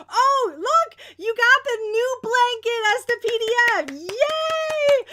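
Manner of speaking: loud, high, delighted announcement; excited cheer. Real, not acted. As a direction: cheer loudly for the winner's prize. Cheering the winner's prize.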